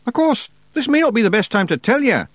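high, glum little Scots voice; miserable Scottish Feegle. A narrator's reading. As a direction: mutter the bad news sheepishly. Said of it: "Rob went on miserably".

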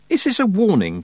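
high-pitched, challenging shout; shouted question. A narrator's reading. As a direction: demand an answer at a shout. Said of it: "Tiffany shouted".

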